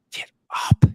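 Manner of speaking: breathy whispered plea to get up; urgent whisper. Retold or imitated. Coach urging the fallen player, whispered. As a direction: drop to a whisper, pleading. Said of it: "The coaches were screaming out of their heads".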